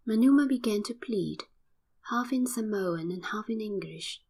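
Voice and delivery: ordinary delivery, nothing marked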